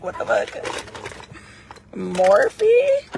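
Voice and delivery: high, loud, incredulous squeal on Morphe; mock disbelief. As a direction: act stunned that it's Morphe of all brands. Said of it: "You're like".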